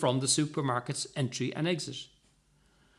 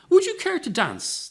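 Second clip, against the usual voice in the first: much higher, loud courtly invitation; old gentleman; 14 semitones higher, 5 dB louder than usual. A narrator's reading. Old gentleman asking handbag lady to dance, high. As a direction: gallantly ask her to dance.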